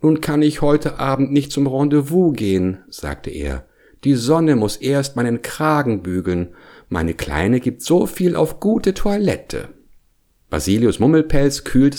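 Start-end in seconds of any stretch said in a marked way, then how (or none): 0.0-2.8 high-pitched fussy bear voice; vain bear, aggrieved. A narrator's reading. Bear grooming his ruined collar, lamenting his evening. 4.0-9.7 high-pitched fussy bear voice; vain bear, aggrieved. A narrator's reading. Bear grooming his ruined collar, lamenting his evening.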